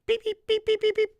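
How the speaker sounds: high squeaky birdlike beeps; bird chirps. Retold or imitated. Preacher imitating the birds in the air, high chirping.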